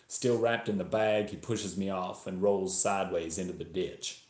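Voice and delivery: put-on story read in a Texan accent; attempted Texas accent. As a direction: narrate as a Texan, imitated accent. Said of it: "I'm going to attempt a Texas accent".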